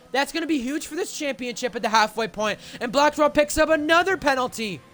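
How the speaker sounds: excited live race call; motorsport commentator. Real, not acted. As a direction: call the crash with rising energy.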